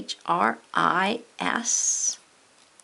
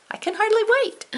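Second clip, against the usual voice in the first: much higher, eager squeal; excited; 4 dB louder, faster than usual. Real, not acted. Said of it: "Ooh, so excited".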